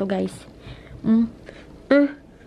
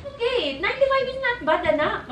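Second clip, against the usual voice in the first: reassuring; 8 semitones higher than usual. Retold or imitated. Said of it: "mother reassuring daughter".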